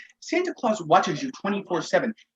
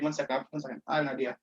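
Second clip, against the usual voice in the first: hushed aside; 5 semitones lower, 8 dB quieter, faster than usual. Real, not acted. Pausing the recording to set up a bit.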